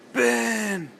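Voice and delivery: high shouted call across a swamp. Retold or imitated. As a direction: yell the name out, loud and high. Star Wars impression, calling out.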